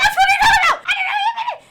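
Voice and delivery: Billy shocked by the plan, laughing shout. Acted. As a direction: yell it in disbelief.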